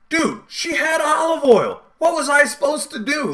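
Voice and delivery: high, slow whiny excuse; defensive friend pleading his olive oil excuse, high and whiny; whiny buddy. Retold or imitated. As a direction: whine out the excuse like a caught kid. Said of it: "that was his defense".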